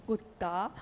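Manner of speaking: कुत्ता फुसफुसाकर, धीमे और नीचे स्वर में; कान में चुपके से कुत्ता कहना; secret ear whisper. Retold or imitated. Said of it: "secret कान में". (कान में धीरे से बोला गया कुत्ता)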